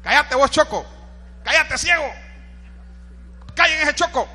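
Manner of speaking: disciples hushing him. Acted. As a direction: tell a noisy beggar to shut up. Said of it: "lo callaban y le decían".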